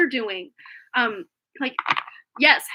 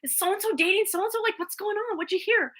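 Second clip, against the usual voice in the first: put-on eager teens; 9 semitones higher than usual. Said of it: "we're like".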